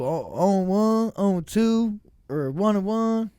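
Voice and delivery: high, slow mock sing-song; imitating the count he called out, mocking it; imitating how he said it. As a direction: tease him by parroting his count, slow and high. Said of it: "that's how you said it".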